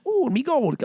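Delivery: high, hokey Irish leprechaun croon; mock the cheesy Irish leprechaun accent; hammed-up fake Irish accent; bad leprechaun Irish accent. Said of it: "His accent offended me".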